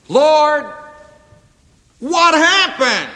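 high, loud, slow cry of "Lord, what happened"; anguished cry. Retold or imitated. A struggling believer crying out to the Lord, anguished. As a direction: cry out to God in bewilderment. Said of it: "And you're asking".

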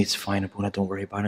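very soft, tearful hush; quiet. Retold or imitated. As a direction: barely voice it, holding back tears. Crying servant voiced softly.

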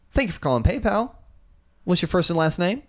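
high scripted service voice, a touch far off; chipper call center agent. Retold or imitated. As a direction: imitate the PayPal agent's canned greeting. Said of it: "I get".

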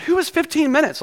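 high, incredulous complaint; mock outrage. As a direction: scoff at the long steeping time. Mock outrage at tea instructions.

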